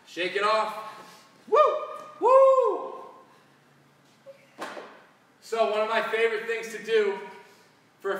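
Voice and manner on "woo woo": high, loud cheer; excited whoop. Real, not acted. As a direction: whoop with joy. Celebrating the end of the set.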